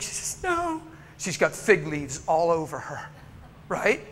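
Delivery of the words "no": high-pitched mimicked woman; small, high acted "no"; refusing woman. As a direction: imitate a woman's distressed refusal. Voices the woman refusing him.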